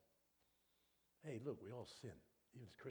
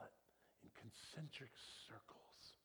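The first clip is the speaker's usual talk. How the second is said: soft, slow awed whisper; preacher whispering heaven's vision; whispered awe; 2 semitones higher, 8 dB quieter than usual. Acted. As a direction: hush it in wonder. Sudden whisper after loud.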